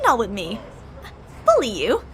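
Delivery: ordinary talk, nothing marked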